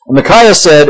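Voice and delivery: ordinary talk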